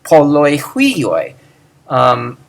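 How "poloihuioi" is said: poloihuioi slow, run together, accent on the end; slow teacher's poloihuioi; model pronunciation. Shown as a demonstration. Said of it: "that's how you should say it".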